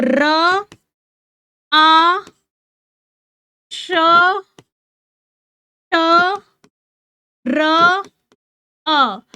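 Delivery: "र, आ, श, ट, र, अ" as separate sounds with long pauses. Shown as a demonstration. Teacher splitting राष्ट्र for pupils to write, sound by sound. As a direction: sound out "र, आ, श, ट, र, अ" one at a time. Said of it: "जैसे अब आप बोल रहे हो राष्ट्र".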